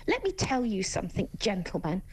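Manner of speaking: ordinary talk, nothing marked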